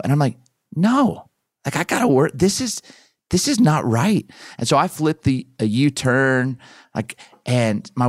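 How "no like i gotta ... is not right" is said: high, worked-up insistence; pushing back hard at his wife, all riled up; a retold rant of rising outrage; agitated protest.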